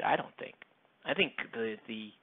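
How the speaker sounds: ordinary talk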